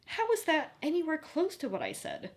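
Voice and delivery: high incredulous question; disbelief. A narrator's reading. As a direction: ask it in total disbelief. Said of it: "stared at her in disbelief".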